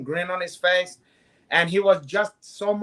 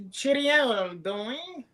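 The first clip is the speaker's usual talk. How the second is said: high, lilting mock posh English greeting; lightly lampoon a proper English accent; a jokey posh English toff; 7 semitones higher, much slower than usual. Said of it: "more proper".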